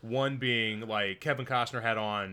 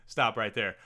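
ordinary talk, nothing marked

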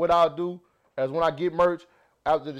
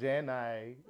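low, quiet drawled name; Forrest Gump; 7 semitones lower, 13 dB quieter than usual. Acted. Teasing friends who want Forrest, hushed mock impression. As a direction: lightly imitate a slow Southern movie hero. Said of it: "Except Forrest Gump".

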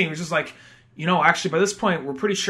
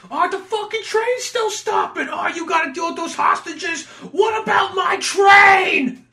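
hammed-up New York griping; mock whiny outrage; 13 semitones higher, 5 dB louder than usual. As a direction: gripe like a selfish transit boss. Voicing office guy complaining.

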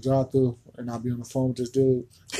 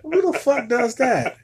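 exasperated; 9 semitones higher, 6 dB louder than usual. Real, not acted. Exasperated rant about cashiers.

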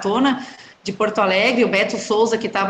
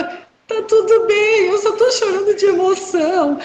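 high, slow, teary reassurance; imitated crying voice; 9 semitones higher than usual. Runner to worried stranger at the fence, mock sobbing reply. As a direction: play the sobbing runner telling a stranger she's fine. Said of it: "comecei a chorar".

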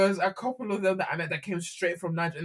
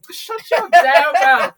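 loud, slow playful retort; playful mock anger; 7 semitones higher, 13 dB louder, much slower than usual. Real, not acted. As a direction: fake offence, stretched out.

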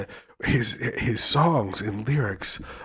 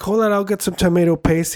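lightly acted, higher-pitched call; imitating party host; 10 semitones higher, 5 dB louder than usual. Retelling him going away from the party, imitated call. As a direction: call it out higher and louder, as if excusing yourself. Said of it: "he's like".

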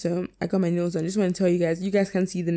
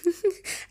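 high giggle; 12 semitones higher than usual. Real, not acted. Laughs at own chickpea joke.

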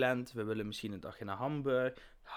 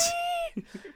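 squeaky high voice; slower than usual. Acted. Exaggerated sing-song reply.

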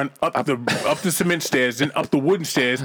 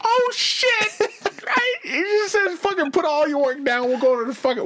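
much higher, laughing excitement; mock delighted boss; 13 semitones higher, slower than usual. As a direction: hype the office field trip. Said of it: "your boss is like".

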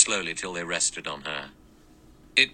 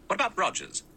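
questioning; 13 semitones higher, faster than usual. Acted. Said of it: "Lombard said".

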